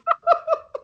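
high, breathy burst of laughter. Real, not acted. Mid-story about the bouncer, a high laughing burst. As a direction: break up laughing. Laugh during retold exchange.

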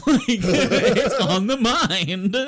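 slow and loud, words pushed out through laughter; laughing. Real, not acted. Retelling Gabe's baby remark, laughing helplessly. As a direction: give in to the laugh.

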